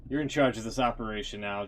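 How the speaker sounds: usual speaking voice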